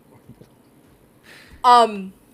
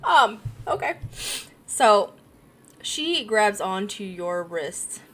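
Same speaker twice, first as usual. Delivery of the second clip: ordinary talk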